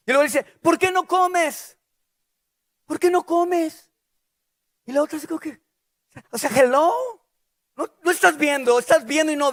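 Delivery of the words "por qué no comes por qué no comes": mock clueless husband. Elcana voiced asking Ana.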